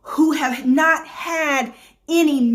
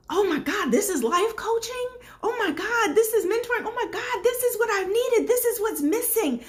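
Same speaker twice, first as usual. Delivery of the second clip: high, hushed, emotional client voice; breathless and overwhelmed with gratitude; gushing grateful client; 7 semitones higher, 4 dB quieter than usual. Retold or imitated. Clients telling their coach how much they needed this, high and hushed. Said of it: "the clients on the show saying to me".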